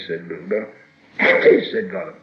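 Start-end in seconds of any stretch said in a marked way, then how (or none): 1.2-1.7 very high, loud creature squawk. A narrator's reading. Gollum blurting his first guess, high and loud.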